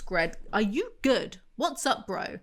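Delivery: ordinary talk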